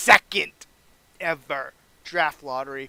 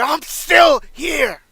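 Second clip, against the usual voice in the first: much louder and higher, very slow, stretched out; defiant shout; 10 semitones higher, 12 dB louder, much slower than usual. Pretend. Foley defying the Legend Killer, full shout. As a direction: scream it at the crowd in defiance.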